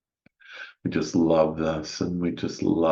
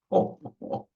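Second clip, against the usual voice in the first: short, light burst of laughter; a brief gleeful laugh, no words; playful laugh. Acted. Guide acting out play with the inner child, playful laugh.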